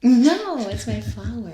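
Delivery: sudden loud objection; loud protest. Real, not acted. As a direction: blurt it out indignantly. Indignant protest at drawing.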